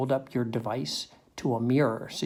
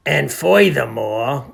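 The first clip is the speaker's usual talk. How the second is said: loud, slow, put-out workman voice; Milo the janitor; 2 semitones higher, 12 dB louder, slower than usual. Acted. Janitor demands an apology from the narrator, indignant. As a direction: draw it out with self-importance.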